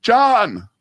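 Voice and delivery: loud high shout across the street; yelling a name. Retold or imitated. Friend shouting to the director on a bike, loud call. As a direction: call out loud to get his attention. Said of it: "yells out".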